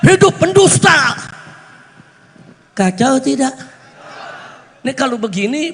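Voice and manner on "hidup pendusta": higher, forceful mock cheer; retold rallying shout. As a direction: imitate a slogan-shouter, pitch raised. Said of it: "Eh, dia teriak". (menirukan teriakan dukungan dengan nada tinggi)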